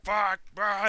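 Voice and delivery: high, loud mock cry of effort; imitated frustrated yell. Player picturing his friend running at the gate, mock yell. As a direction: yell it as someone charging a gate. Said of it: "He's just like".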